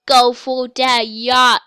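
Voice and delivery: loud, slow, drawn-out cheer; urging on. Real, not acted. Player egging the shark on to the yacht, loud urging. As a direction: cheer it on loudly, stretching each word.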